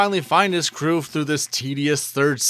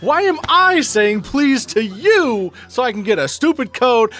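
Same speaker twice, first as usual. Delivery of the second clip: much higher and louder, slow outraged yell; louder, drawn-out indignant shout; exasperated yell; 8 semitones higher, 4 dB louder, slower than usual. Real, not acted. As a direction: vent real outrage at the kidnapper. Ranting at the game character.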